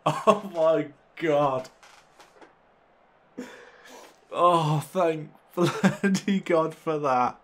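slow, high and loud relieved exclamation; real drawn-out delight; elated relief. Real, not acted. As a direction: stretch it out in joyful relief. Relief after winning shootout.

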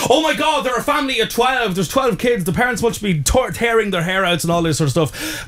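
high, exaggerated shocked voice; mock shocked gossip. Host mocks people shocked by a family of twelve, high. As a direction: gasp it like a scandalised neighbour. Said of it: "everybody concentrates on this thing".

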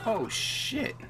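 surprised. Real, not acted. Sudden danger in game.

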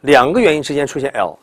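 ordinary talk

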